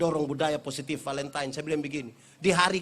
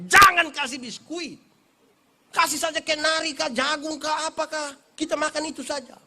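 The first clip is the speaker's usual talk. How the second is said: insistent plea; 8 semitones higher, 5 dB louder than usual. Retold or imitated. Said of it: "bapak kan bukan datang tiap hari".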